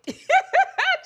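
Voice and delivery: high, loud burst of laughter; real cackle. Real, not acted. Woman urging girl to just do it, cackling.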